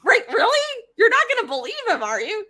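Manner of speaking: high, loud incredulous protest; can't believe anyone would buy this; outraged older brother appealing to father; indignant Apollo. Retold or imitated. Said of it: "Apollo's like".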